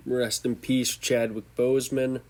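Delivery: usual speaking voice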